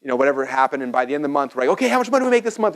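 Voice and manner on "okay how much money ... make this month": high, fast, eager question; excited. Retold or imitated. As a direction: ask eagerly for the month's results. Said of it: "We get all excited".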